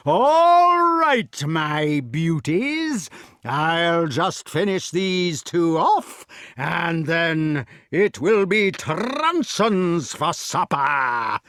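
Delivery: slow, loud threatening giant; menacing giant. Acted. Giant threatening the prisoners, slow and gloating. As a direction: savour every threat slowly.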